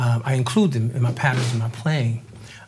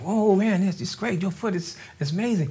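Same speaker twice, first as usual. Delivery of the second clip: much higher, retold wow; excited praise; 8 semitones higher than usual. Band members raving after the jam, high excited praise. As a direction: gush like bandmates hearing his foot. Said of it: "they were like".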